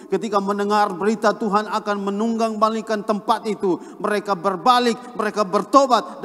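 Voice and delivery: high, loud, sustained sermon cadence; impassioned preaching. Real, not acted. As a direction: drive each phrase home at full force. Preacher builds to full force. (khotbah lantang berapi-api)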